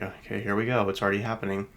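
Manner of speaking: normal talking voice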